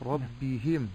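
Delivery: ربهم with hi stretched to hee; lengthened hā. Shown as a demonstration. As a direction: linger wrongly on the hā of ربهم. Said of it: "مددت الهاء". («ربهم» بإطالة كسرة الهاء)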